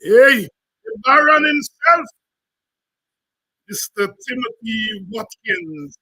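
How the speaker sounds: high, loud showman introduction; announcer intro. Acted. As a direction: announce the star to the crowd.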